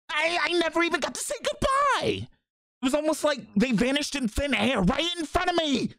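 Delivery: high-pitched, upset cry; acted high, distressed wail; distraught outcry. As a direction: cry it out high, rushing toward the end. Same line acted as high outcry.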